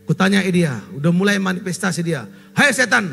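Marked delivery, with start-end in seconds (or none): 2.5-3.1 loud rebuke of a demon, barked out; commanding shout. Retold or imitated. Pastor to the demon at night, a loud commanding shout. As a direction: order the demon to leave, loudly. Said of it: "aku bilang".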